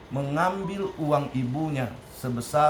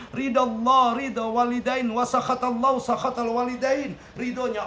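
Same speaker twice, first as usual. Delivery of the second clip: preaching; 9 semitones higher than usual. Acted. Preacher urging the congregation to honor mothers.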